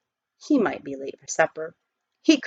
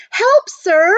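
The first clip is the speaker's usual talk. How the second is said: high, loud call up from a pit; calling for help; 11 semitones higher, 7 dB louder than usual. Acted. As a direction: shout up to the stranger above. Boy calling up from hole.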